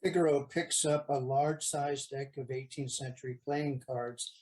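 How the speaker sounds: ordinary delivery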